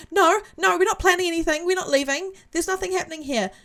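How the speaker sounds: hasty cover-up. Acted. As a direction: deny everything in a high, innocent-sounding rush. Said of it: "turns towards this figure and goes".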